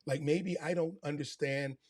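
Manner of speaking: ordinary talk, nothing marked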